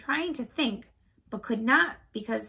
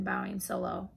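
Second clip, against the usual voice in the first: ordinary delivery, nothing marked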